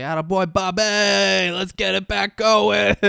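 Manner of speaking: loud, high cheer from the stands; root hard for Bobby over the crowd; cheering fan. Retold or imitated. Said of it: "Gil's cheering".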